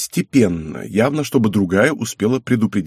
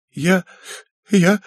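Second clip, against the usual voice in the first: put-on high, halting tearful girl; acted weepy little voice; 9 semitones higher than usual. A narrator's reading. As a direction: stammer it high, on the verge of sobs. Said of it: "всё же расплакалась".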